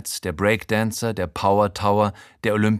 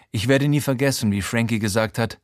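plain reading voice

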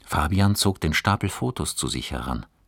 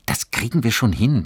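put-on higher, louder boy's reassurance; encouraging; 7 semitones higher, 6 dB louder than usual. A narrator's reading. Johannes bucks up his sighing friend, encouraging. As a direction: cheer a friend on lightly. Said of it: "einen aufmunternden Schubs".

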